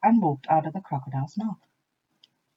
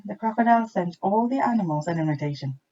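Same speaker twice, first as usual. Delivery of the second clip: ordinary delivery, nothing marked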